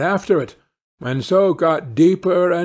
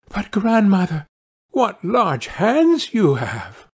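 high, drawn-out amazement; high little-girl wonder; 7 semitones higher, slower than usual. Acted. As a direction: marvel as a puzzled child. Girl marvels at disguised wolf.

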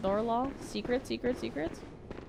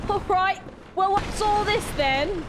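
high, raised-voice Cockney; exaggerated Cockney, pitched way up; mock Cockney; 8 semitones higher, 3 dB louder than usual. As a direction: play a hammed-up Cockney sizing up trouble. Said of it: "Did a Cockney accent".

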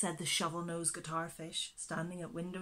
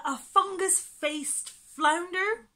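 higher, slow musing guess; 11 semitones higher, 9 dB louder, slower than usual. A narrator's reading. Monkey-faced prickleback guessing the name, slow and high. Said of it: "guessed the monkey-faced prickleback".

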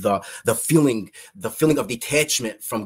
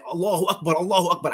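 desperate retold scream; 6 semitones higher, faster than usual. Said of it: "I kept on screaming that".

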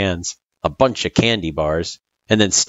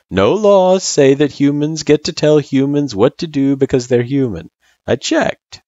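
reassuring friend, pitched higher; lightly voiced laid-back friend Sans; 9 semitones higher, 5 dB louder than usual. A narrator's reading. Friend assuring the girl she is safe, lightly voiced. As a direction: lightly act a friend backing her up.

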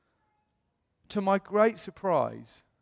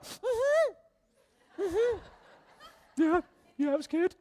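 high, strained voice holding back tears; a squeezed-up reply, fighting back tears; choked up; slower than usual. Retold or imitated. To his friend after the city trip, choked up and high. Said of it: "And started crying".

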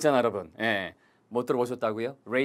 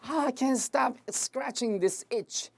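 high, slow, exasperated itch line; itchy groan; 9 semitones higher, 3 dB quieter, much slower than usual. Pretend. Teacher demoing the itch sentence, high and drawn out. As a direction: moan it like the itch is unbearable. Acted itchy sigh before phrase.

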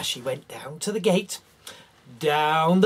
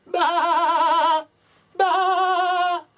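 high, loud bleat; lamb bleating; 10 semitones higher, 4 dB louder than usual. Acted. Frightened lamb bleating to call the shepherd. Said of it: "call out".

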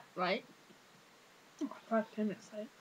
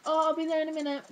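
higher-pitched busy teacher imitation; mock teacher put-off; 5 semitones higher, 3 dB louder, faster than usual. Teacher putting off a pupil with hand up, mock higher voice. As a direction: brush off a waiting pupil in a raised voice.

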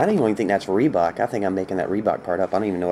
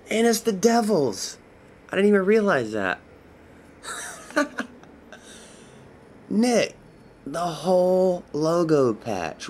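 thrilled disbelief; 8 semitones higher than usual. Real, not acted. Seller tells buyer Nick his Devils patch came, thrilled squeal. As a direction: gush like you just hit the jackpot.

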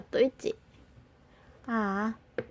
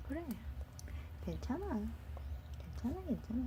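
hushed reassurance, almost breathed; soft soothing; 2 semitones higher, 16 dB quieter, much faster than usual. Real, not acted. Soothing baby while feeding.

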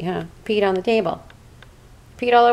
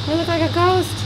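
higher, teasing sing-song; playful high repeat; 8 semitones higher than usual. Real, not acted. Owner joking to the cat about the foggy camera, playful and high. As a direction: toss it up high, playing with it.

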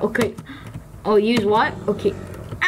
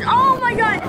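normal talking voice

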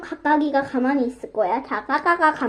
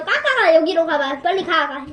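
ordinary talk

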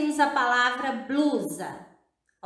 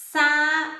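saúde said louder and higher, each vowel clear; emphatic example word; 4 semitones higher, 5 dB louder than usual. Shown as a demonstration. Teacher presents saúde to child, emphatically. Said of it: "ó, na palavra".

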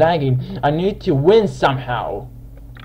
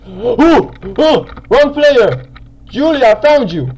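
high, loud startled cries; excited outburst; 9 semitones higher, 7 dB louder than usual. Real, not acted. Gamer spots Julie mid-hunt, high excited shouting. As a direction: yelp it out in the heat of the chase.

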